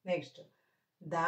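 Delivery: ordinary talk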